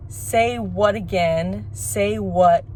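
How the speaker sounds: very slow, deliberate mock threat. Jules warning Brett in a retold scene, slow and deliberate. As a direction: draw out each word as a warning.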